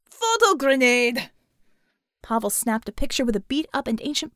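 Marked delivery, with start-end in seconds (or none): 0.0-1.3 loud, slow, boisterous Russian bear; loud playful Russian bear; bear's shout. Acted. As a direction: announce it like a big happy brute. Pavel bursts in with camera.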